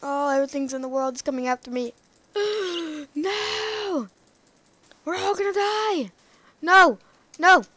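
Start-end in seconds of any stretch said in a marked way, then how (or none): 2.4-6.1 high gasp into a hammed-up wail; mock panic. Mobs chasing him. 6.6-7.6 loud shouted no; mock shouting. Mobs chasing him.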